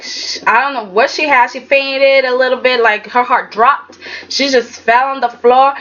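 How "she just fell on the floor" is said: usual speaking voice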